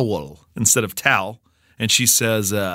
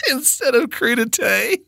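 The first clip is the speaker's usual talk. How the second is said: laughing, high; 13 semitones higher, slower than usual. Real, not acted.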